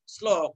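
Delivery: শ্লোক begun with English "sl"; low, clean s opening শ্লোক; dental s sound. Shown as a demonstration. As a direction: say শ্লোক as slok, not shlok. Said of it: "শ্লোক নয়".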